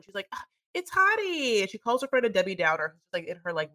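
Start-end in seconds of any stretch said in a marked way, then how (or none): 0.3-1.6 high, drawn-out gushing; mock excitement. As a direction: imitate a thrilled friend, slow and high. Acts out showing off fiancé's ring.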